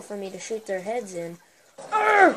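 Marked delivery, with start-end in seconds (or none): 1.9-2.4 short, loud high grunt; frustrated grunt. Real, not acted. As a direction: grunt hard as you fire. Grunt while shooting.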